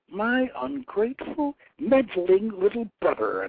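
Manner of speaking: high, scornful sneer at a sibling; spat contempt. A narrator's reading. Anath sneers at her brother Baal with contempt. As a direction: spit the words with disdain. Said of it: "Anath spat".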